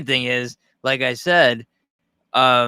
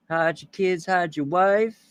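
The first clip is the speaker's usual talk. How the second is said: higher, put-on news-clip voice; Antoine Dodson impression; 5 semitones higher, 4 dB quieter, slower than usual. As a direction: quote the viral clip in his voice. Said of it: "Antoine Dodson".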